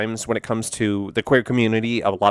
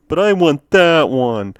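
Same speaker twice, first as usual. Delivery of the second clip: higher, slow, louder whine; Homer; 4 semitones higher, 5 dB louder, slower than usual. Acted. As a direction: drag out a childish cartoon-dad demand. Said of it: "Homer's got".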